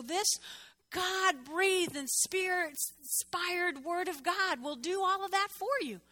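high, slow stressed words; preaching emphasis. Real, not acted. Preacher urging congregation to open the Bible, slow. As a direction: proclaim each word slowly.

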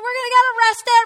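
high, fast, loud panicked yell. Retold or imitated. On a date, yelling at her boyfriend in panic. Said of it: "And I'm yelling".

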